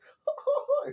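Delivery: loud, very high gleeful laugh, imitated; happy whooping laugh. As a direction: imitate someone's giddy delight. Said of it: "he's, like, hella happy".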